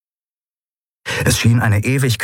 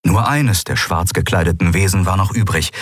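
plain reading voice

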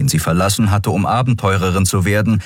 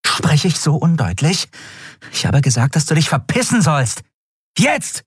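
high, sharp angry warning; furious threat; 8 semitones higher than usual. Acted. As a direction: threaten him as you grab him. Andriel packt ihn am Kragen. (wütend drohend)

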